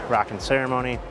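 normal talking voice

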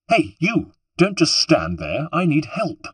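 high, indignant hail to a bystander; high-pitched imperious call; calling for help. A narrator's reading. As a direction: demand help urgently from across the water. Stranded woman calling to bystander.

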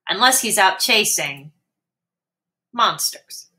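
usual speaking voice

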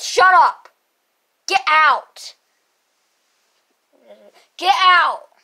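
loud get out; shouting. Acted. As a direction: throw them out with your voice. Kid kicking others out.

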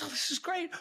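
high, soft delighted aside; gleeful warlock. Retold or imitated. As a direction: relish it quietly and high.

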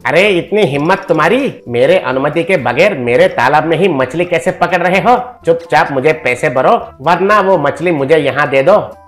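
mildly angry, demanding rich man; indignant at being defied; Mohanlal, angry. Acted. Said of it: "Mohanlal गुस्से से". (हल्के गुस्से से)

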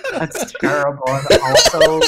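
words spilling through laughter; laughing. Real, not acted. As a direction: laugh while you protest. Reacting to silly nickname.

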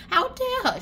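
very high indignant squeal; mock indignation. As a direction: squeal it as if scandalised. Repeated acted indignation.

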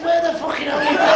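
hammed-up frustrated shout; indignant yell. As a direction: shout it after her, outraged. Frustrated man shouts after her.

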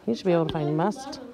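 quick hushed whisper. Real, not acted. Teacher whispering a hint to the student.

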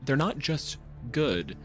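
normal talking voice

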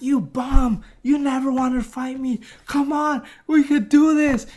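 imitating a boxer calling him out; taunting rival. Rival taunting Rocky, voiced.

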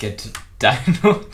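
slow loud gloating Get dinoed; drawn-out loud taunt. Real, not acted.

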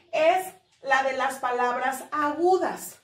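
ordinary talk

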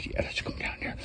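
soft whisper, speaking as the cat. Pretend. Owner voicing her cat in a whisper. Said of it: "she says".

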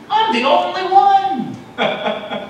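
very slow, high, drawn-out whine; milk the self-pity; self-pitying wail. Acted. Elijah wailing self-pity to the Lord, high and slow. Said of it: "be like Elijah".

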